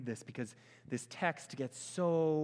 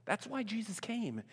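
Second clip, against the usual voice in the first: ordinary delivery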